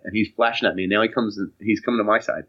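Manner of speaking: usual speaking voice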